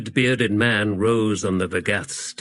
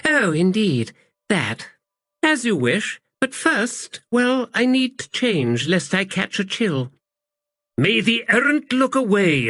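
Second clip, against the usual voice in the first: high, lofty noblewoman voice; haughty princess; 10 semitones higher than usual. Acted. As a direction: answer sweetly from above. Princess replies to captain.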